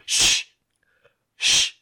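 long hissed shush, pause, repeated; imitated shushing. Little kid hushing a chatting family on a train, imitated shush. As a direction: imitate a small kid hushing grown-ups. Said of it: "to be quiet".

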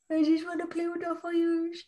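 put-on high, giddy little-kid voice; squeaky pyromaniac kid; childish fire lover. Teasing, playing a fire lover.

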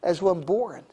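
ordinary talk

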